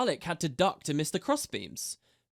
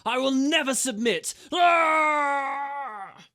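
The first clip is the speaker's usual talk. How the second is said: defiant bellow ending in a cry; 11 semitones higher, 7 dB louder, much slower than usual. A narrator's reading. Chained Char defies her captor, bellowing. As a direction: roar your refusal at full force. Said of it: "Then she bellowed".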